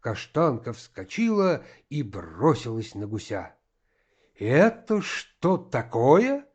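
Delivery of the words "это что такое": slow, high, loud indignant question; loud, angry voice. A narrator's reading. Master walks in on the fight, loud and angry. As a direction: stretch it out, angrily raising the voice. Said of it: "послышался громкий сердитый голос".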